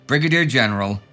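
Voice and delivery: normal talking voice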